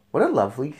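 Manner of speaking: ordinary delivery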